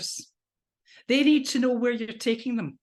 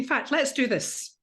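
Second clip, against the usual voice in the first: ordinary talk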